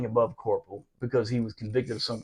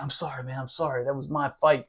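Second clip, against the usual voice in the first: much softer mock apology; begging forgiveness; 9 dB quieter than usual. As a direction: plead quietly, imitating an apologetic man. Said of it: "he begged my forgiveness".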